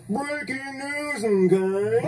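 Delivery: Mister Goodman impression. Puppet shows off his Mister Goodman voice, slow news read. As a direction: announce it like the news anchor. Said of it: "I can do a mean Mister Goodman".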